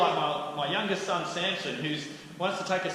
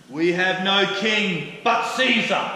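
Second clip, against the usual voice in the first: louder, higher, slow and deliberate; defiant declaration; 6 semitones higher, 9 dB louder, slower than usual. Acted. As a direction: proclaim it with cold resolve. Chief priests reject Jesus.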